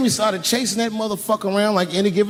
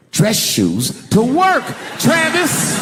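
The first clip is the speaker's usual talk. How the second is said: high, slow, loud yelling of the name; shouted punchline; 9 semitones higher, 3 dB louder, much slower than usual. Real, not acted. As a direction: drag it out and shout it at him.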